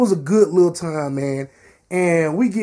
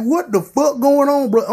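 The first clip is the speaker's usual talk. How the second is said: angry surprise; 8 semitones higher than usual. Retold or imitated. Drunk narrator soaked by a drink.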